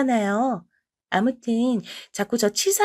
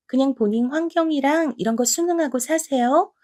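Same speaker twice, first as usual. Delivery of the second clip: ordinary delivery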